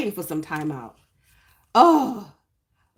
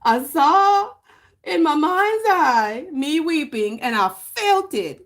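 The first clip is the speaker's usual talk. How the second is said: mock emotional; 8 semitones higher, 5 dB louder, slower than usual. Dramatic retelling of weeping.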